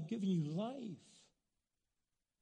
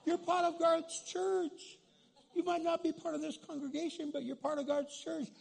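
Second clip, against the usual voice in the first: much higher, louder, laughing welcome; joyful welcome; 9 semitones higher, 3 dB louder than usual. Retold or imitated. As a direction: embrace her with delight. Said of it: "We said".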